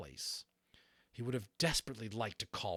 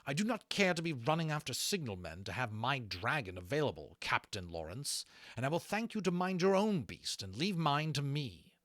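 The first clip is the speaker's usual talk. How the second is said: cold, raised rebuke; 7 semitones higher, 6 dB louder than usual. A narrator's reading. Rankin rebuking Lawrence, icy and raised. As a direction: freeze him out coldly. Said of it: "Rankin said very coldly".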